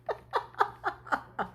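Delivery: high, airy burst of laughter; breathy laugh. Real, not acted. Laughing with viewers at the silly dream, airy laugh.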